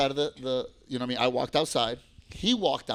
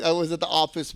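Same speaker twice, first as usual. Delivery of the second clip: ordinary delivery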